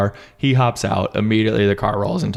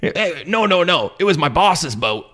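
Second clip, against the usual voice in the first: higher, careless captain; a hint of breezy sailor; Captain Ron voice; 8 semitones higher than usual. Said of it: "Basically like".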